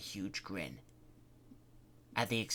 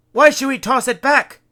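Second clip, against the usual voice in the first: higher and louder demand; 14 semitones higher, 17 dB louder than usual. Acted. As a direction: challenge her hard. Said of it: "Larry demanded".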